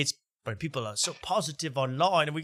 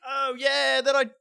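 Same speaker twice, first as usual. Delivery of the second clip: high-pitched mock British woman; hammed-up British imitation, pitched way up; fake bad British accent; 11 semitones higher than usual. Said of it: "her fake bad accent".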